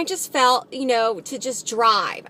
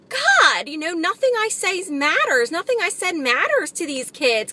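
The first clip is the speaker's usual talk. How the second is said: high, pleading outburst, easing off; exasperated anger; 6 semitones higher than usual. Retold or imitated. Venting to God about these kids, exasperated. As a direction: relive the frustrated cry, starting high. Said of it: "I was really feeling a lot of anger".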